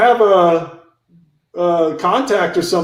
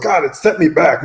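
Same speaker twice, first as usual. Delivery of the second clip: ordinary talk, nothing marked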